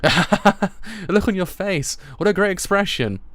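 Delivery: loud, high, amused crowing; teasing through laughter; gleeful teasing. Acted. Peddler laughs at boy's shock.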